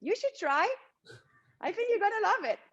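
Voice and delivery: cheerful reply. Retold or imitated. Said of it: "and I'm like".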